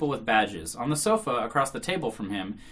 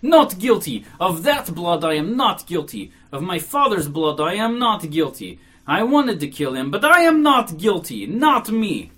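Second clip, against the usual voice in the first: loud, high, emphatic protest of innocence; loud cry; 5 semitones higher, 8 dB louder, slower than usual. A narrator's reading. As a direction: cry it out, punching each phrase. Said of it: "and cried loudly".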